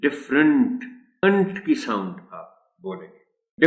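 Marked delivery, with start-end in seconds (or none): 0.1-0.9 "different" said with a clear -ent ending; correct pronunciation. Shown as a demonstration. Teacher models "different" for the class, careful pronunciation. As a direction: model "different" with a crisp final nt. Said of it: "the correct pronunciation of this word is".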